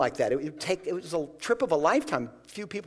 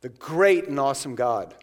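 prayer voice; 6 dB louder than usual. Retold or imitated. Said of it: "He says".

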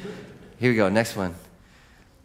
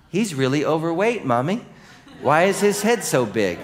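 lightly voiced little girl, higher; imitating little girl; 6 semitones higher than usual. Child asks her mommy about the preacher, lightly voiced. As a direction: ask it like a nosy kid tugging at mom. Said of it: "These are things little girls have said".

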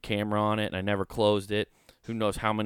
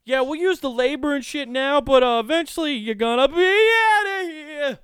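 high, loud falsetto mocking the officials; smug high mock voice of the government; 7 dB louder than usual. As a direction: sneer it in a squeaky put-on falsetto. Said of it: "They're like".

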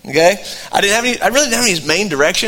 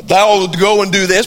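mock voice of God; slower than usual. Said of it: "not necessarily an audible voice".

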